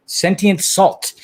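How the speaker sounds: Sentient Salt said slowly and carefully; careful name. Real, not acted.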